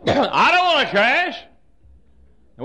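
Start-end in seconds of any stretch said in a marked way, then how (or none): 0.1-1.4 mock panic. Scared on burning plane.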